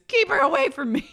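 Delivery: high, laughing plea; imitating Vicky; mock Vicky. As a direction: squeal it while laughing. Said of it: "Vicky goes".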